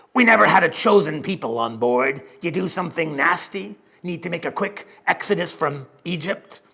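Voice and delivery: loud, grinning pirate-like sailor; gruff sailor. Acted. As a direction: bellow it like a grinning old sailor. Sailor speaks in story.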